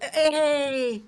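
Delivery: loud, slow warning calls; sharp scolding. Real, not acted. Owner scolding her cat at the laptop, loud and slow. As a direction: stop the pet in its tracks.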